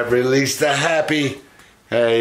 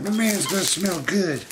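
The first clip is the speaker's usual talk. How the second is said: high, playful dog voice; voicing the dog; 9 semitones higher, 3 dB quieter than usual. Owner voicing the dog about her man in a high voice. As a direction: speak for Mila in a high pretend voice. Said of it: "She's like".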